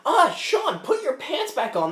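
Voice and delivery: high, loud mock-appalled outburst; exasperated scolding. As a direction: scold a friend in comic horror. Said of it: "we're like".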